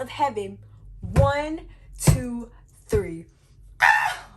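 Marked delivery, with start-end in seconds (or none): 1.0-3.3 loud pin count. Real, not acted. Calling the pinfall count. 3.8-4.3 excited shout. Real, not acted. Reaction to win.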